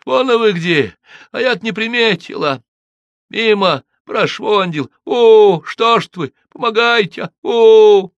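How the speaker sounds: high, loud, tearful wailing; wailing plea. A narrator's reading. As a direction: wail for help across the ice. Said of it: "подвывающий плаксивый голос". (подвывающий плаксивый голос)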